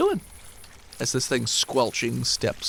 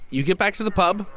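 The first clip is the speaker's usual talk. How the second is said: usual speaking voice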